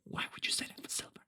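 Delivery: breathy whispered jab at the text; whispered aside. Real, not acted. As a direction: whisper it under your breath, incredulous. Mocking aside about the text.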